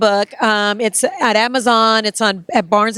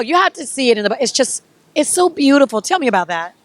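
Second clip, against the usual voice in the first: ordinary delivery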